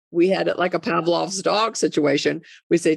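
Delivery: ordinary talk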